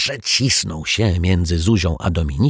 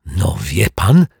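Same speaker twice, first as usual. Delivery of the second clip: mild indignant gasp; 4 dB louder, slower than usual. A narrator's reading. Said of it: "Dominika zatkało z oburzenia".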